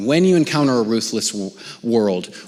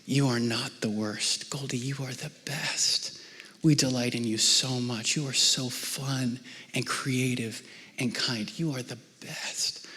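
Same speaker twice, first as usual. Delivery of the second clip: tender reassurance; 10 dB quieter than usual. Retold or imitated. Father reassuring his crying daughter, soft and tender. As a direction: comfort a crying child gently. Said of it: "I reminded her who she really is".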